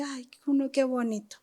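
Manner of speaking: soft, doting coo; tender coo. Retold or imitated. Recalling Leslie's laughing baby: soft, doting coo. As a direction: melt over a giggling baby. Cooing over babies.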